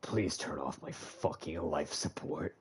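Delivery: soft slow deadpan plea; deadpan despair. Real, not acted. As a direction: mutter it, flat resigned.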